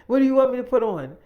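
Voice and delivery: fast, loud, high retold question; exasperated demand. Woman demanding David choose the costume, exasperated.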